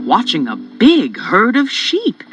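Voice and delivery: ordinary talk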